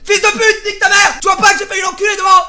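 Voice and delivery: high, loud screamed insults; hurling abuse out the window at top volume; furious road-rage shout. Retold or imitated. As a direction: yell at the driver behind, full fury. Retold insult at another driver.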